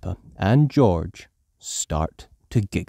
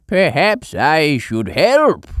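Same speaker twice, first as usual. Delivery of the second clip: put-on slow, louder old grandpa; Grandpa Pig voice; 6 semitones higher, 7 dB louder, slower than usual. A narrator's reading. Grandpa Pig watching Peppa climb, slow old voice. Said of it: "says Grandpa Pig".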